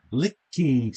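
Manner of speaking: "Licky" said slowly with care; pronunciation demo. Shown as a demonstration. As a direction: spell out "Licky" by sound for a pupil.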